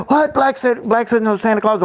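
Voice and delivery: put-on high, squeaky kid shocked to hear no Santa; hammed-up child's disbelief; mock disbelief. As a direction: play a stunned child, voice shooting up. Kid's mock reaction, no Santa.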